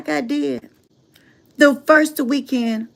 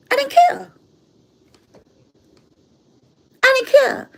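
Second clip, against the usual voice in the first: much higher, carefree; gleeful; 11 semitones higher than usual. Real, not acted. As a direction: toss it off happily. Gleeful repeat.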